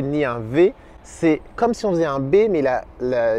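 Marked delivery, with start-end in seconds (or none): none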